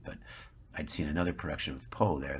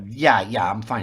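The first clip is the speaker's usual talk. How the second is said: louder, insistent brush-off; flustered reassurance; 5 semitones higher, 10 dB louder than usual. Retold or imitated. Embarrassed man assures a stranger he's fine, flustered.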